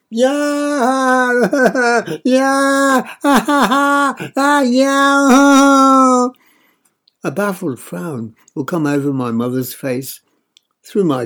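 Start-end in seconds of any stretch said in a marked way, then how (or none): 0.1-6.3 loud, high hammed-up wailing sobs; fake crying wail. A narrator's reading. Boy fake-crying at his mother, wailing loudly. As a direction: open the floodgates, bawl theatrically. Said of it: "really open the floodgates".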